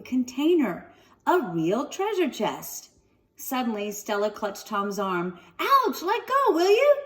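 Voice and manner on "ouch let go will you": very high, loud squeal. Acted. Tom when Stella grabs his arm, squealing. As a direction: yelp like a boy being pinched. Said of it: "he squealed".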